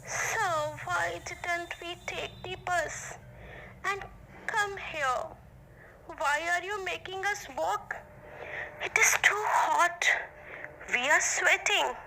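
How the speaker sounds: put-on children grumbling to their teacher on a hot walk; complaining children. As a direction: whine like tired schoolkids. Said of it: "children started complaining".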